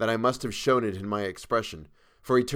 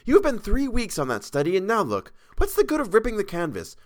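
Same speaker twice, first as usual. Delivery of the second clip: much higher, louder scolding; 10 semitones higher, 4 dB louder than usual. A narrator's reading. Model scolds painter for ruining brushes, higher louder scolding. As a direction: tell him off sharply, higher and louder. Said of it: "she promptly began".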